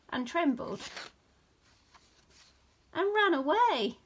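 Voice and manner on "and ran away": high, bright surge of excitement; excited. A narrator's reading. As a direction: lift the voice up as they scatter. Leaves run away.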